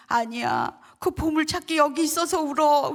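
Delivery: emotional outburst, lightly acted; tearful elder. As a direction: imitate an elder exclaiming through tears. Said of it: "그 장로님이 또 울더래요".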